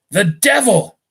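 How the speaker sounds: startled exclamation. Acted. Redcoat reacts in acted dialogue.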